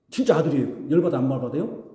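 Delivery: ordinary talk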